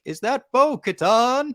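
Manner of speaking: high, drawn-out mock holler; imitated yelling. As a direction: call it out like a distant voice yelling, stretching the words. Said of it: "a voice yelling".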